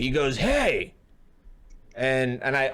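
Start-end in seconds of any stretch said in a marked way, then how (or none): 0.4-0.9 higher mock holler; retold yell. Storyteller as angry stranger fifty yards away, mildly yelled. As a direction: imitate a man hailing from down the road. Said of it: "he yells at me".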